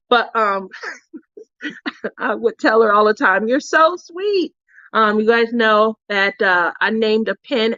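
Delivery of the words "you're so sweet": high, drawn-out adoring compliment; affectionate gushing. Retold or imitated. Speaker telling her friend all the time, affectionate gushing. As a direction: coo it slowly to a dear friend.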